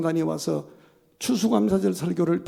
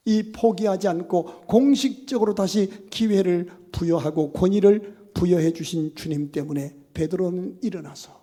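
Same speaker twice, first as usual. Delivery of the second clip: ordinary talk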